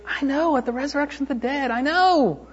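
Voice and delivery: high, strained retort; acted exasperated cry from a mourner; pained insistence. Voicing Martha's pained retort to Jesus.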